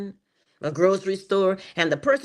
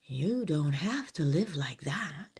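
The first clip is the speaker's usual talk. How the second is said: whisper; 4 semitones lower, 6 dB quieter, slower than usual. Acted. Hushed reassurance to listeners.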